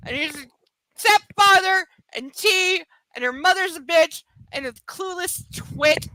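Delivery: high, rising exasperated rant. Real, not acted. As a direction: rattle it off, losing it. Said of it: "I can't".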